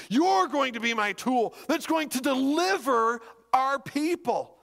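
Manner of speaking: high, emphatic divine commission; God. Acted. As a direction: proclaim the mission with conviction. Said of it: "He says".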